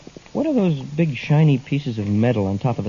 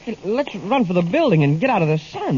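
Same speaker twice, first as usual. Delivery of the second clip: panic; 8 semitones higher than usual. Acted. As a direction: shout as the heat beams close in. Trapped by closing heat beams.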